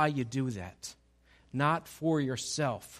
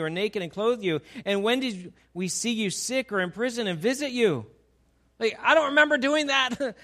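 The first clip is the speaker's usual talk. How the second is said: higher and louder, incredulous questions; baffled disciples; 6 semitones higher, 5 dB louder than usual. Acted. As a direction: protest in disbelief that you never did it.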